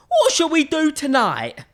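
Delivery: high, loud cartoon skeleton; big skeleton voice. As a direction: bounce it out like a skeleton. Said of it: "he said".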